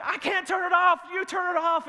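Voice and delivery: high, loud reenacted argument; frantic shouting back. Dad to daughter over the hose, frantic shout. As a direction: throw the order back urgently.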